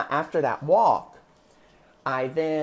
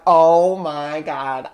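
slow, much louder thrilled "Oh my God"; excited gasp; 11 dB louder, much slower than usual. Retold or imitated. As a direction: let the thrill burst out slowly. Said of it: "now I'm starting to get, like, excited".

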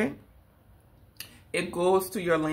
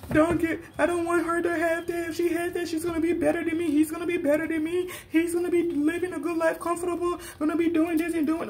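high, whiny mock voice of a jealous rival; hammed-up petty jealous voice; mocking jealous whine; 11 semitones higher than usual. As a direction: pitch it way up and sneer their envy. Mocking jealous person's words.

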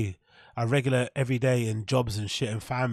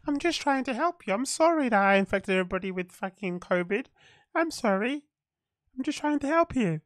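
much higher, small meek mocking voice; Bill Gates voice; 9 semitones higher than usual. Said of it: "Bill Gates voice".

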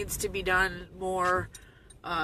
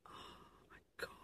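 shocked whisper; 26 dB quieter, much faster than usual. Real, not acted. Shocked at near miss.

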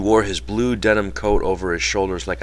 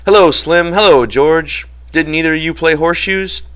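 put-on high, loud old swamper; cheery old-timer calling out; old man voice; 9 semitones higher, 6 dB louder than usual. A narrator's reading. Old Candy enters.

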